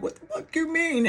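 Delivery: high-pitched, shocked "what the fuck"; shocked disbelief. Retold or imitated. Sister hearing bad news from brother, shocked and high. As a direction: fire back in stunned disbelief. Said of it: "And I'm like".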